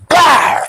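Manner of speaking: a high, loud shouted "bah" like a rifle shot; shouted gunshot noise. Retold or imitated. Telling a friend about the killing, shouted gunshot noise. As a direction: blast it out like a gun firing. Gunshot in retold movie scene.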